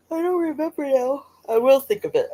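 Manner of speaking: yawning. Real, not acted. Streamer losing her train of thought, yawning. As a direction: talk through a real yawn. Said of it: "That's a yawn".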